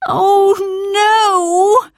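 high, loud dismayed wail; groaning. Acted. Dan stuck in flour and jam, high loud wail. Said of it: "groaned Dan".

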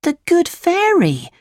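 put-on very high puppet boy exclamation; Pinocchio, amazed. A narrator's reading. Pinocchio recognises his friend the fairy, high amazed voice.

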